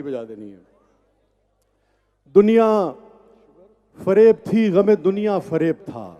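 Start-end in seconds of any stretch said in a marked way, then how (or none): 2.3-6.1 measured, emphatic verse recitation; poetry recital. Acted. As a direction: recite it to a live audience. Recites ghazal at mushaira.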